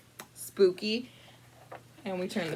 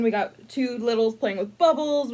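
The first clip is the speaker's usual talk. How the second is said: ordinary delivery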